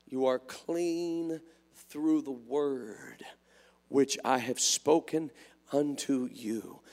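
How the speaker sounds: low, slow, solemn preacher; hushed scripture recitation. Real, not acted.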